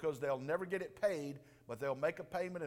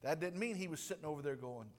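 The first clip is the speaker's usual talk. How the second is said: usual speaking voice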